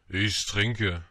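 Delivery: glum, weighted reply; gloomy drunkard. A narrator's reading. Drinker answers the little prince, gloomy delivery. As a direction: answer darkly, as a brooding drinker. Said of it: "antwortete der Säufer mit düsterer Miene".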